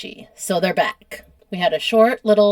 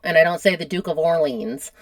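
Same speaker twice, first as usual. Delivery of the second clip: ordinary talk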